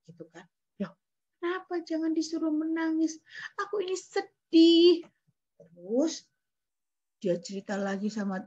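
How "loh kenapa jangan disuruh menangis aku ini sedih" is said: higher, rising to a loud sad wail; hurt and sorrowful cry; protesting widow. Acted. Widow tells Jesus she is sad, higher wail.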